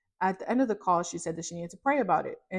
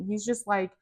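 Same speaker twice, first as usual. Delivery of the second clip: usual speaking voice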